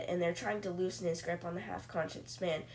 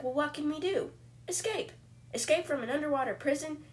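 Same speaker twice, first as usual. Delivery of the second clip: incredulous; 7 semitones higher than usual. Retold or imitated. Prisoners panicking in retold story.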